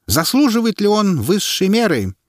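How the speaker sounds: high, ringing public question; herald. A narrator's reading. Herald putting the verdict to the crowd, ringing.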